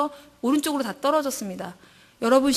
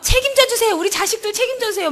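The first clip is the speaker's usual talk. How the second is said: pleading prayer; 10 semitones higher than usual. Retold or imitated. Mother begging God to care for her children, high pleading cry. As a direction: plead desperately for your children.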